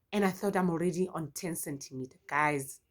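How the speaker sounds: usual speaking voice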